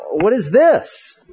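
high, drawn-out baffled question; slow, incredulous rise in pitch; bewildered disbelief. Acted. Visitor bewildered by church fight.

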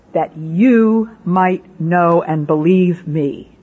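YOU drawn out, then slow spaced words; solemn, deliberate weight on "you"; stress on YOU. Retold or imitated. God's words quoted with deliberate emphasis.

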